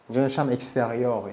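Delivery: normal talking voice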